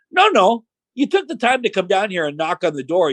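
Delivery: mock insistence. Boss sarcastically insists wrestler stay.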